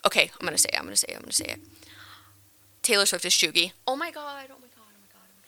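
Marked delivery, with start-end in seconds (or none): 0.0-1.6 whispered, psyching herself up; nervous whisper. Real, not acted. 3.9-5.4 hushed panic. Real, not acted. Aghast after saying hot take.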